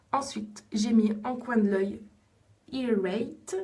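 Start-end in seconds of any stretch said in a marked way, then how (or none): 2.7-3.6 the shade name said in a playful English accent. Shown as a demonstration. Showing viewers her English accent on the shade name "Irate". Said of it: "Et mon super accent anglais".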